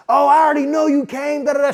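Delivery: mock scolding girlfriend, high and sing-song; berating. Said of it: "She starts berating me".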